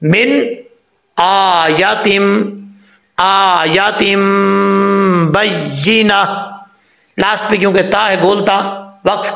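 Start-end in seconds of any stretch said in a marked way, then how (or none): 1.2-6.2 slow आयातिम with the hamza held long; correct recitation. Shown as a demonstration. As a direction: recite आयातिम बय्यिना slowly, hamza drawn out. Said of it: "हमजा को लंबा करना".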